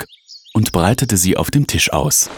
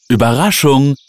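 high, sing-out reveal; excited shout; 9 semitones higher, 4 dB louder than usual. A narrator's reading. Children revealing their gift to mother, calling out. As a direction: call it out gleefully. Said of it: "riefen die Kinder".

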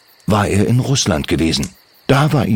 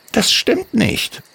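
much higher, slow little kobold voice; kobold Timmy; slower than usual. Acted. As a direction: gently contradict her as a tiny goblin. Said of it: "entgegnete Timmy".

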